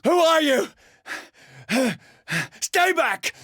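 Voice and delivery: high alarmed cry of fright; startled cry. Acted. Startled by a stranger, a frightened high cry. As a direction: recoil and shout in fear.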